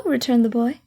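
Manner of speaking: ordinary delivery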